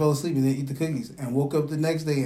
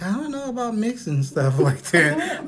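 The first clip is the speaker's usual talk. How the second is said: a hint of a hesitant mother, voice pitched up; mock the worried mom, higher; imitating mom; 7 semitones higher than usual. Son imitating his hesitant mom, lightly raised voice. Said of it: "That's how she sounded".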